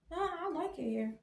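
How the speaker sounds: high, soft airhead voice; lightly mocked ditzy girl; daughter impression. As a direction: play her spacey and clueless.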